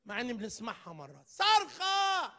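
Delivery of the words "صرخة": high, slow shouting plea; desperate cry. Retold or imitated. Preacher voicing the man crying for help, high shout. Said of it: "قلت الصرخات".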